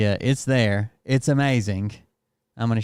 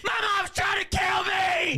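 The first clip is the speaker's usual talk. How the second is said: high, drawn-out terrified scream; hammed-up panicked shriek; screaming for help; slower than usual. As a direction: scream like a kid fleeing for his life.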